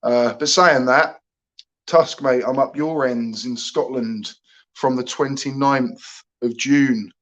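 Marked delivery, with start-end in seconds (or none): none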